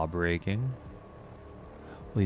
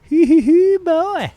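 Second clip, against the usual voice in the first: giggling; 13 dB louder, slower than usual. Real, not acted. Delighted by something in game.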